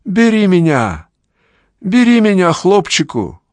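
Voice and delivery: very high, loud pleading cry; desperate cry. A narrator's reading. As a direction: beg him, voice soaring. Ghost girl begs desperately.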